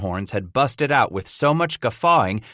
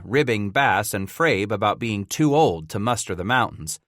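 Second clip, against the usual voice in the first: usual speaking voice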